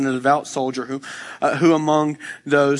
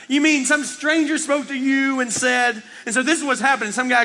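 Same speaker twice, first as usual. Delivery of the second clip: incredulous; 9 semitones higher than usual. Acted. Reacting to a stranger's claim about God, incredulous.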